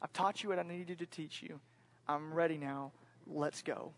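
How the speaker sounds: calm, soft assent; gentle, settled go-ahead with pauses; God. Retold or imitated. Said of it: "God says".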